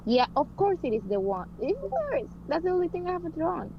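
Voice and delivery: ordinary delivery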